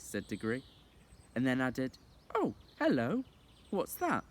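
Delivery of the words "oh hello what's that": high surprised exclaim, a little louder; light up at something new; boy surprised by a guinea pig; sudden surprise. Acted. Diggory spots the guinea pig.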